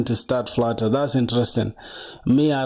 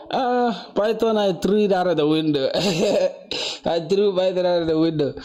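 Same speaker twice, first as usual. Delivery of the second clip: laughing; 5 semitones higher than usual. Real, not acted. Telling viewers he ditched Python, laughing. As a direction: laugh it off as you toss the language away. Joking about dropping Python.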